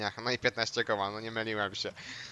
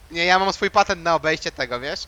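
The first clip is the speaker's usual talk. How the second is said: normal talking voice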